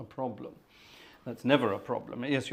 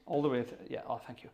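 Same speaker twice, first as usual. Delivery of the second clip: normal talking voice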